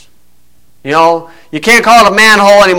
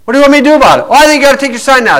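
high-pitched retold bickering; acted argument; 7 semitones higher, much faster than usual. Sign holder and angry mother argue, retold and pitched up. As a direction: replay a roadside dispute, pitched high.

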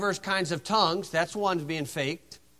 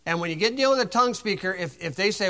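ordinary delivery

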